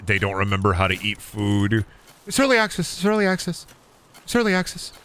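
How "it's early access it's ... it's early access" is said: high, sing-song mocking voice, far higher; high mocking whine; mock whiny excuse. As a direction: parrot the excuse in a silly high voice.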